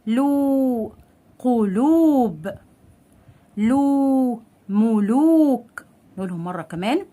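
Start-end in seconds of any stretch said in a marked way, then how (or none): 0.1-5.9 slowly stretched oo in "لو", "قلوب", "ملوك"; long waw madd. Shown as a demonstration. Teacher lengthening the lam, drawn-out "لو", "قلوب", "ملوك". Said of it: "واعمل اطالة لللام".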